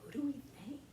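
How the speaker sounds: soft hushed question; whispered. A narrator's reading. King whispering in the quiet sacred grove. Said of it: "he whispered".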